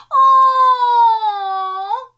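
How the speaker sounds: high, loud drawn-out wail; long tearful "aww". Real, not acted. Moved by the dream's sweet ending, long wail. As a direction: let out a high falling wail.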